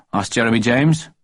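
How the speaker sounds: ordinary delivery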